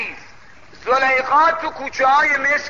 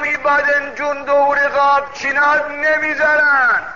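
drawn-out, higher beggar's cry; calling out; 5 semitones higher, slower than usual. Retold or imitated. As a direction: call out to the passing king, slow and high. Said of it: "داد زد".

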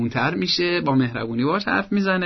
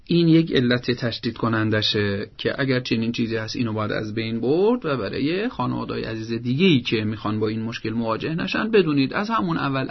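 normal talking voice

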